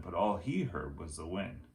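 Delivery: plain reading voice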